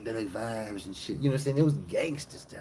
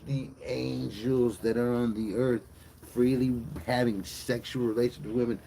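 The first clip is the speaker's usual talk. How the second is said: usual speaking voice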